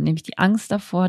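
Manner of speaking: ordinary talk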